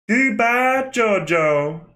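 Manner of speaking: high, hammed-up villain farewell; theatrical anime villain cry; imitating Dio. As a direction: call it out high, stretching the name. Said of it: "That's literally how he says it".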